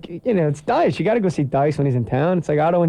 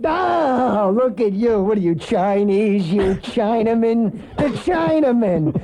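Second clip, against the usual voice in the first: higher, drawn-out heckling comic; Andrew Dice Clay; 4 semitones higher, slower than usual. Retold or imitated. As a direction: jeer slowly as a crude stand-up impression.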